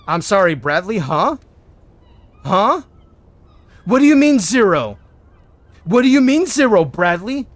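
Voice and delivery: loud, high incredulous scolding; mock outrage. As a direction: play the boss exploding at a useless dealer. Acted scolding of dealer Bradley.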